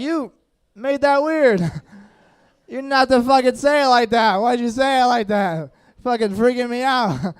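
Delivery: high, loud freaked-out protest. Retold or imitated. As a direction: yelp it, creeped out. Freaked-out retort to doctor.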